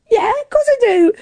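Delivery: high-pitched, over-sure reply; mock assurance. Swimmer answering crew about a mile, mock assurance. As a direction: insist in a high voice that it is obvious.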